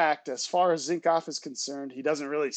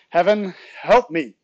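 loud, higher plea to heaven; exasperated mother's cry; 3 semitones higher, 11 dB louder than usual. A narrator's reading. Mother driven crazy by her son, exasperated cry. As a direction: cry out for help at wit's end.

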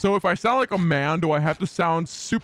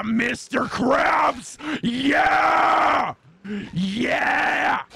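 hammed-up drawn-out cartoon shout, climbing higher; Mister Krabs catchphrase; 5 semitones higher, much slower than usual. Goofing for the chat, hammed-up cartoon yell. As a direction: belt each "yeah" long and high.

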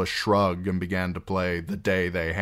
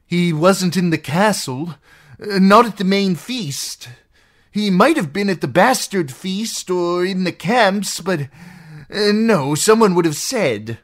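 high, loud, hesitant answer; anxious recall; 13 semitones higher, 8 dB louder than usual. Acted. Captive trying to recall for outlaws, anxious. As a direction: search your memory under threat.

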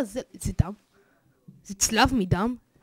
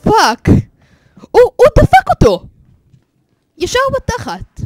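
high, loud cry of disbelief; high-pitched horrified exclamation; shocked yelling; 11 semitones higher, 13 dB louder than usual. Real, not acted. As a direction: blurt it out loudly, appalled. Shocked by what he witnesses.

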